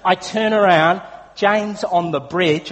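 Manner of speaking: normal talking voice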